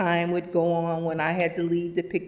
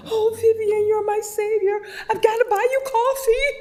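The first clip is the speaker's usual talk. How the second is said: much higher, louder, thrilled friend; gushing relief; 6 dB louder than usual. Retold or imitated. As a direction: gush your thanks like she saved you. Sherry's relieved gushing thanks.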